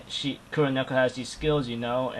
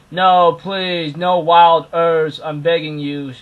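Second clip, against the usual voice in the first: mock begging, louder and drawn out; pleading; 4 semitones higher, 8 dB louder, slower than usual. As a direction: plead like a put-upon anime character. Acted character begs in anime recap.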